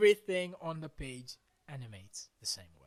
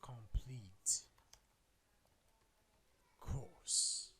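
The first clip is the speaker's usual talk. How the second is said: spooky voice; 2 semitones higher, 14 dB quieter, slower than usual. Pretend. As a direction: speak it like a ghostly announcer. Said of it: "I sound spooky, right?".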